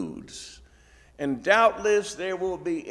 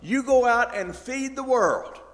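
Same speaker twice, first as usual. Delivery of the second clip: Jesus's charge; 9 semitones higher, 5 dB louder than usual. Retold or imitated. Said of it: "Jesus is telling us".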